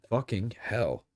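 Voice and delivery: fucking hell in a plain American accent. Shown as a demonstration. Streamer trying the phrase in American accent. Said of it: "fun to say in an American accent".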